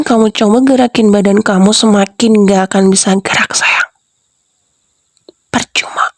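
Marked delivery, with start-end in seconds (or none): 1.7-6.1 whispered taunt. Acted.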